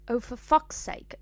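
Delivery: put-on over-proper British accent on the curse; British and formal. A narrator's reading. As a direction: swear like a very proper British schoolgirl. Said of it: "made that so British and formal".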